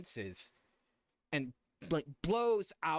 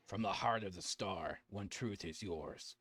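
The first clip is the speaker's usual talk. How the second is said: low, slow, hushed eerie voice; unearthly voice; 7 semitones lower, 5 dB quieter than usual. Acted. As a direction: intone it like a star's ancient spirit. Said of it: "So [name], here".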